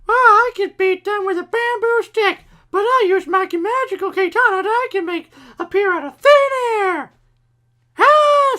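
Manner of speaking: high, loud boastful cartoon voice; Neptune. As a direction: brag in a very high pitch, ending on a yell. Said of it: "And so Neptune goes".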